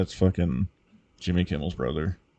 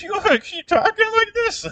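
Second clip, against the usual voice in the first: put-on way higher falsetto kid voice; mock squeaky kid. Mocking the movie kid's reading scene in falsetto. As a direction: shriek it like a flustered boy.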